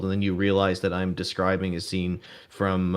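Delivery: usual speaking voice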